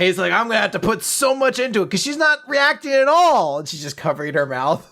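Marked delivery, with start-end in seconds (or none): 0.3-3.6 high-pitched, worked-up resolve; straining determination. Retold or imitated. Masseur to himself about an unreacting customer, fiercely determined. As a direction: push it out with rising effort.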